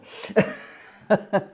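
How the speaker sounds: loud, high burst of laughter; real laugh. Real, not acted. Laughing at the buggered organ.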